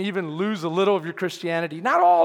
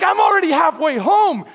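high, shouted objection; exasperated mock shout; loud protest; 10 semitones higher, 10 dB louder than usual. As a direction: protest it loudly, comic exasperation. Protesting to God in story.

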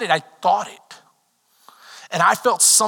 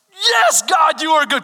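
joyful shout; 6 semitones higher, 4 dB louder than usual. Retold or imitated. Shouting his thanks at finding keys.

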